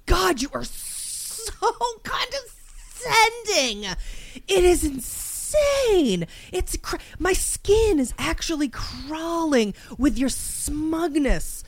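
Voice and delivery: high, drawn-out exasperated wail; theatrical slow howl of outrage; raging out. Pretend. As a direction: stretch each key word in seething disgust. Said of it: "raging out in my mind".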